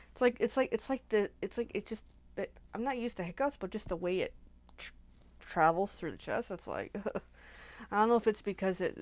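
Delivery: ordinary delivery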